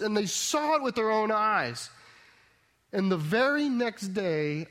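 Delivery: soaring sermon voice; impassioned preaching. Acted. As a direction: preach it, building to the climax. Preaching at full force.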